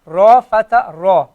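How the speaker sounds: "ra" said with a rounded "ro" vowel. Shown as a demonstration. Teacher showing students the round "ra" as "ro". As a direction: round the lips so "ra" comes out as "ro". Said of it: "because ra is a round letter".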